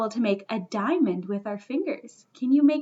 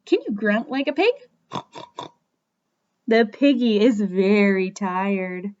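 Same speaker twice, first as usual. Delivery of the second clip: ordinary talk, nothing marked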